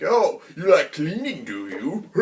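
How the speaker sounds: slow, sneering, mocking thug voice; taunting bandit. A bandit taunting Shanks as he cleans up the mess, slow and sneering. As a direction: needle him while he cleans up. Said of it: "just kinda giving crap for it".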